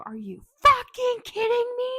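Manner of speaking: imitating a high, loud outburst; outraged yell. Retold outraged yell from inside house.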